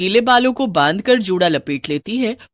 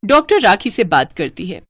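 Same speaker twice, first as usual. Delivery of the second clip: usual speaking voice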